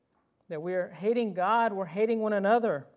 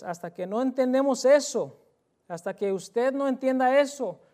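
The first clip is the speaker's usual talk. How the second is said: raised, louder preaching push; emphatic preaching; 6 semitones higher, 7 dB louder than usual. Real, not acted. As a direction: drive the point home to the congregation. Predicador enfatiza con fuerza. (predicando con énfasis, más alto y fuerte)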